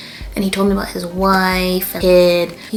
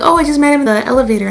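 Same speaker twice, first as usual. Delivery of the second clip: quick retold reply; 6 semitones higher, 5 dB louder, much faster than usual.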